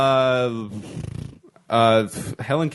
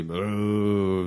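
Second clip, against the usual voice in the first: vampire voice; 5 semitones lower, 5 dB quieter than usual. Acted. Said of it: "I did a vampire voice".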